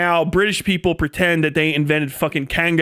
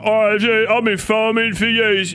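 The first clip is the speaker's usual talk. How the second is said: mock British farmer; 3 semitones higher than usual. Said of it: "They're like".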